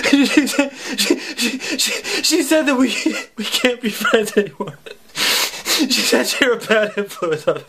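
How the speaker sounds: stammering, half-laughing mock sobs; hammed-up blubbering kid; imitating sobbing friend. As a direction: stutter through fake tears. Friend sobbing retelling mother.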